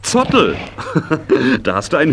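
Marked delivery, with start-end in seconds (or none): 0.0-0.5 high amused question; amused surprise. Acted. Man hearing the pony's name, amused and surprised. 0.6-1.6 a short chuckle at the name; warm amused laugh. Acted. Man charmed by the pony's name, short laugh.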